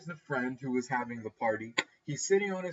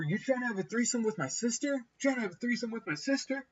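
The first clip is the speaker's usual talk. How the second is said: mock accusing yell; 7 semitones higher than usual. Drunk girl's brother accusing the friend of a threesome, mock yell. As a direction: imitate an incredulous accuser without real volume. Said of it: "just yell at him straight up".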